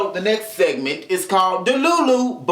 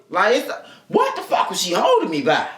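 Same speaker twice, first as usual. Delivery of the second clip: usual speaking voice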